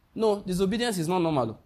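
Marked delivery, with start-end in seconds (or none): none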